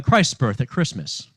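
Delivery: ordinary delivery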